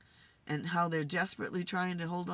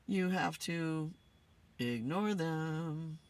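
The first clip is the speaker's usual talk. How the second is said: slowed for emphasis; much slower than usual. Real, not acted. Stressing advice to ignore.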